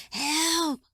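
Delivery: high, drawn-out cry of help; weak call for help. A narrator's reading. Injured man calls out again, high weak yell. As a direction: call out high without full force. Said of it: "the weak voice called again".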